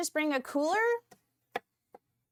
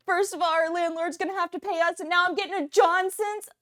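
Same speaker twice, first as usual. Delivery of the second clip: loud, higher, thrilled burst; excited; 6 semitones higher, 6 dB louder than usual. Real, not acted. Streamer thrilled at a gift, loud excitement. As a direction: gush loudly at great news.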